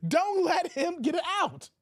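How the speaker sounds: usual speaking voice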